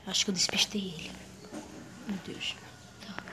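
hushed whisper while hiding; whispering to stay hidden. Acted. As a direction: breathe it out so he won't hear. Hiding from a predator.

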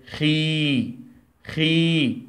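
teacher sounding out خي; syllable demo. Shown as a demonstration. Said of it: "أو ننطقه إزاي؟".

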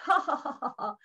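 mock group laugh; hammed-up ha-ha of amused animals; acted laughter. Animals laugh at the bear's request, mock laughter. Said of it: "they all laughed".

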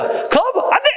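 high-pitched mock refusal; hammed-up exclaimed no; flat-out refusal. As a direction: reject it outright, voice jumping way up. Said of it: "무조건 뭐야".

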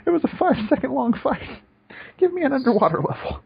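high-pitched exasperated complaint; mock exasperation. Mock complaint about the game's levels.

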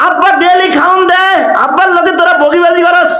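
put-on high complaining boy's voice; pleading, scolding a father; child scolding father. Ibrahim scolds his father, retold. (বাবাকে বকা দেওয়া ছেলের উঁচু গলা)

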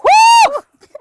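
high, loud, slow threat; shouting. Acted. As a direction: bellow the last words. Mock threat across the table.

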